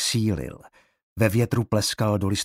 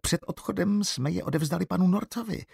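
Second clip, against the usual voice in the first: trembling voice; 7 semitones higher than usual. Acted. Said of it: "roztřeseným hlasem".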